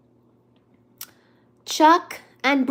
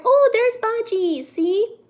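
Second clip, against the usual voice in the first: excited; 10 semitones higher, 3 dB louder, slower than usual. Real, not acted. Spots cat, excited.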